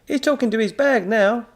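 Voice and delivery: high, loud mocking chuckle in voice; high jeering shopkeeper laugh; laughing counter man. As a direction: laugh at the boy. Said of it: "the man laughed".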